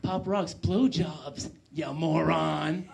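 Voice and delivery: slow, drawn-out deadpan scorn; withering, stretched-out put-down; contemptuous. Acted. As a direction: explain it slowly, as to a fool. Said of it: "looks at me like I'm the dumbest person".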